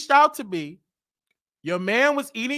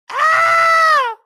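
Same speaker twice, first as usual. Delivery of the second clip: a sudden high yelp, much louder; a hammed-up pleasure cry; mock moan yelp; 8 dB louder than usual. Comic telling a crowd about a cheating wife, mock yelp. Mocking a man in bed.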